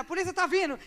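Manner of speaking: loud alarmed cry; alarmed shout. Retold or imitated. Woman at the invasion shouting the police are coming. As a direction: shout the warning like the siren just went off.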